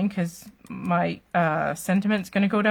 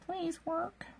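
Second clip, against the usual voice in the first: softer, higher hopeful plea; hushed begging to the paper; 10 semitones higher, 14 dB quieter than usual. Real, not acted. Begging the piece to stay.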